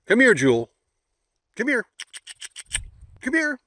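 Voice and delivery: calling the dog loudly; high, loud call to a dog. Real, not acted. Calling the dogs.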